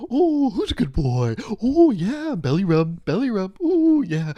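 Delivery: put-on high, gushing baby voice at a dog; doggy baby talk. Friend cooing over the host's dog in high baby talk. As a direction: coo at the dog like it's a baby.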